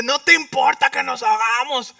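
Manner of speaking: panicked plea. Acted. Disciples panicking in the storm.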